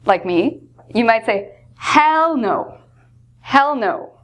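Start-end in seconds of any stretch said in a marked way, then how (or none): 1.8-4.1 "Hell no" with hell raised high and hit hard; strong refusal. Shown as a demonstration. Opinionated teacher answering about Bush with a strong hell no. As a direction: refuse emphatically, punching hell. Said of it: "a very strong way of saying no".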